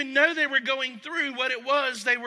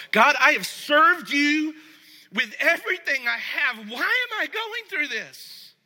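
frustrated prayer; 5 semitones higher, 5 dB louder than usual. Retold or imitated. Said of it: "maybe you felt like this before".

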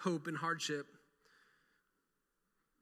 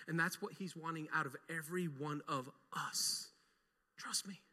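low, soft sermon voice; hushed preaching; 5 semitones lower, 7 dB quieter than usual. Acted. Pastor speaking softly to his congregation, low and earnest. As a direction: draw them in quietly, earnestly.

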